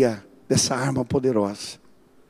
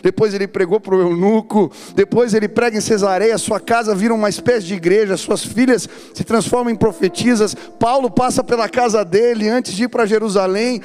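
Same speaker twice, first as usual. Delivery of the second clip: rising louder and higher, building to a climax; fiery preaching; 4 semitones higher, 4 dB louder than usual. Acted. Preacher rallying the church to take up God's word, building to a roar. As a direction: whip up the congregation like a revival preacher.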